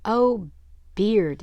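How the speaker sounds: loud, excited gasp of wonder; excited exclaim. Acted. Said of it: "a tiny witchy pony".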